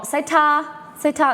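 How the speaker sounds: put-on high sing-song voice to a child; talking to a kid. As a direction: coax a toddler to say thanks. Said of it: "you say to a kid".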